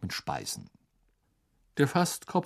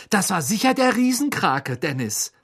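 put-on high, eager boyish voice; excited brother; 10 semitones higher, 4 dB louder than usual. A narrator's reading. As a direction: tell him with wide-eyed delight. Older brother excited about sea monster.

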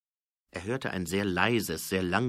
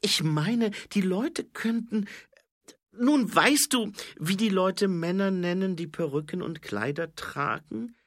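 higher-pitched voice with careful pauses; hesitant policewoman; 11 semitones higher, 4 dB louder than usual. A narrator's reading. Angua explaining difficult things to Nobby, high and hesitant. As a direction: feel your way into an awkward question. Woman delicately explaining to colleague.

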